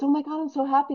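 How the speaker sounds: put-on a kid bursting with relief and joy; overjoyed kid. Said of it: "and he goes".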